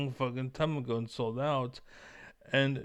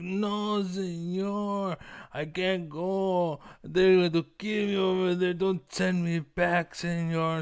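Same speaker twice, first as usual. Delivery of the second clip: high, pleading mock Mexican accent; Slowpoke Rodriguez; 6 semitones higher, 4 dB louder, slower than usual. Slowpoke begging not to be deported, high mock Mexican accent. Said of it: "Slowpoke, Slowpoke Rodriguez, uh, is the character from Looney Tunes".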